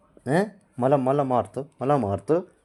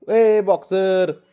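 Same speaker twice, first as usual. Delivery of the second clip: slow, stretched, louder teasing call; playful tease; 6 semitones higher, 6 dB louder, much slower than usual. Real, not acted. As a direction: draw out ए boxer to tease. Teasing baby kicking. (चिडवत, ताणून हाक)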